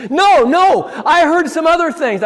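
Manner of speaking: high, loud cutting-off insistence; firm boss retort. Retold or imitated. Administrator cutting off coach's excuse, high and loud. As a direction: shut him down, you already know. Administrator presses about the trip.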